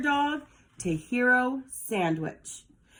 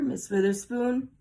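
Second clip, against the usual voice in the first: usual speaking voice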